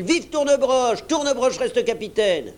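rousing cheer; crowd cheering. A narrator's reading. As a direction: hail your captain as one crew. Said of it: "s'écrièrent-ils".

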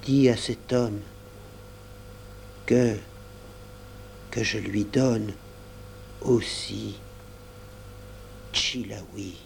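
a dying little whisper of a voice; faint murmur. A narrator's reading. Child saying goodbye to her beaver, murmuring. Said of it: "acheva dans un murmure".

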